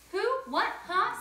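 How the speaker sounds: father woken at night, startled. Acted. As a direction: jolt awake confused. Mother startled awake.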